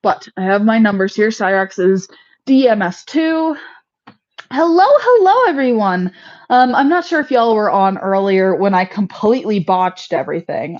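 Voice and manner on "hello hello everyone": sing-song greeting. Real, not acted. Greeting viewers in live video.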